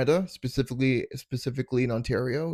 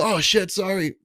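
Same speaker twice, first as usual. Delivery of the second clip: quick, higher, caught-out sorry; startled apology; 8 semitones higher, 4 dB louder than usual. Retold or imitated. Comic apologizes to the teachers, mild startled blurt. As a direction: blurt it like you got caught. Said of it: "And I go".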